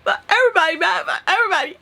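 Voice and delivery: high, mock-tearful drunken whine; drunken wailing. Imitating herself after three shots, high drunken wail. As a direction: imitate your tipsy self wailing. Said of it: "those three shots and whatever".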